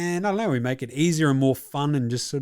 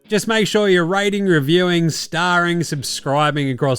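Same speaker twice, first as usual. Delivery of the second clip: louder, higher, pumped-up host plug; upbeat promo read; 4 semitones higher, 4 dB louder than usual. Acted. Host plugging ratings to listeners, upbeat promo voice. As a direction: sell it like a radio promo.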